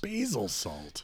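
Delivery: slow doubtful echo; skeptical. Real, not acted. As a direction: question it slowly.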